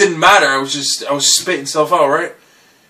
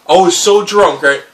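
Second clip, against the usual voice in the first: ordinary delivery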